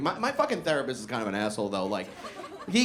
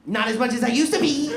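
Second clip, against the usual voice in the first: said quickly; 7 semitones higher, 5 dB louder than usual. Retold or imitated. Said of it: "I said that very quickly at him".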